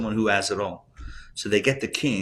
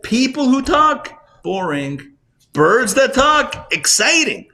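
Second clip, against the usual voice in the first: much higher and louder, slow and emphatic; excited contrast; 8 dB louder, slower than usual. Real, not acted. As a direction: build it up big, stretching each phrase. Contrasting boring talk with talking birds.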